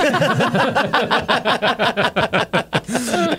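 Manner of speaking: high, rattling run of laughter; real belly laugh. Real, not acted. Storyteller breaks up over pretty funny line, real laughter. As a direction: crack up and keep laughing.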